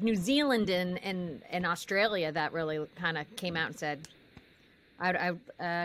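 usual speaking voice

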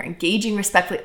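ordinary talk

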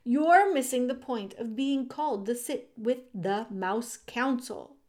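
exasperated scolding. A narrator's reading. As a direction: press the point on a wayward brother. Exasperated brother scolding Despereaux.

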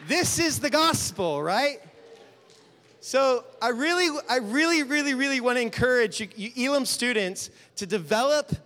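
loud, high preaching exhortation; booming motivational speech. Real, not acted. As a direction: urge them on with full force. Exhorting the students loudly.

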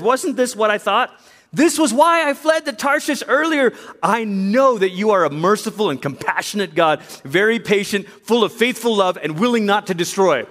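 high, indignant complaint to God; bitter prophet railing at mercy; angry Jonah. Retold or imitated. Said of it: "but he's actually angry".

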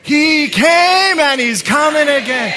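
high, full-force rally shout. Shown as a demonstration. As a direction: give it all the oomph you have. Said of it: "Can we get a little more oomph in that?".

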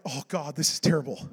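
low, soft re-enacted groaning prayer; private despair. Preacher recalling his prayer during the emergency, quietly despairing. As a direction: drop it low, as if praying to yourself.

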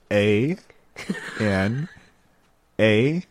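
low, slow letters with long gaps; slow spelling. Shown as a demonstration. Host repeating the spelling for radio listeners, slowly. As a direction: spell it at dictation speed.

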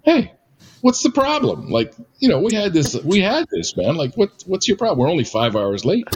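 high, brash nonchalant voice; Dwayne's voice. Retold or imitated. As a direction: friend brushing off the worried family, high and carefree. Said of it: "Dwayne's like".

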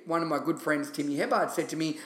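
usual speaking voice

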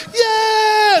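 high loud shout of yeah; high whooping cheer; cheering crowd. Retold or imitated. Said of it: "these strangers are like".

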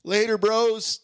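high, laid-back Scooby stoner. Acted. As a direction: drawl it out like a dopey stoner. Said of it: "your Scooby s-, stoner voice".

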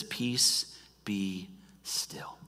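soft, dying to a whisper; preacher whispering the calm after the storm; hushed command. Acted. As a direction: let the words fall to stillness. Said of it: "quiet rebuke then calm".

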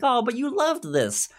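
high, sing-song uncle voice; doting uncle. Retold or imitated. Uncle reminding his niece she loved the song, high and coaxing. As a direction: coax her like a fond uncle. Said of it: "And he's like".

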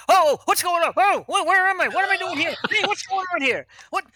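high, hammed-up waking panic; startled confusion. Passenger waking in the aisle after landing, mock startled confusion. Man wakes suddenly, jumps up confused.

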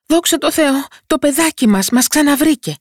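high, joyful thanks to God; relieved parent. A narrator's reading. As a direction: breathe out relief and joy. Father relieved, boy found.

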